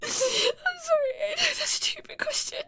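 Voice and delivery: high, tearful, breaking sorry; crying apology. Acted. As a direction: weep the apology out, pitched high.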